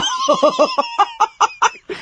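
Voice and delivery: long, high-pitched cackle; howling laughter. Acted. Prankster hearing uncle's mosquito joke, howling laugh.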